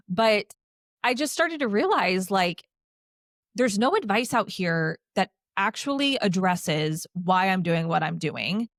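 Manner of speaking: ordinary delivery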